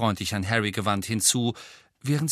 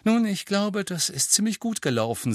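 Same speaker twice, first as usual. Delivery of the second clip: zufrieden; 10 semitones higher than usual. A narrator's reading. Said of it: "sagte Hermine zufrieden".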